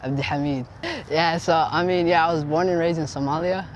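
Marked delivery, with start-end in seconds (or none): 0.0-0.7 Abdi Hamid pronounced the Somali way; Somali pronunciation. Shown as a demonstration. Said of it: "You know how to say it".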